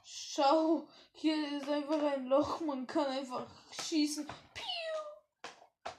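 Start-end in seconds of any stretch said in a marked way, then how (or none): 4.3-5.9 high pew noise; shooting sound effect. Pretend. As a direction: make a toy gun sound.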